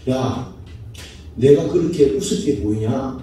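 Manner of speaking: low, lightly acted affronted question; God's challenge. Preacher voices God to those who mock him, lightly acted low challenge. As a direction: drop the voice for a restrained divine challenge. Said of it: "하나님이".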